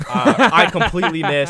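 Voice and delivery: laughing loudly while retelling the missed punch. Real, not acted. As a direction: burst into laughter at his own miss.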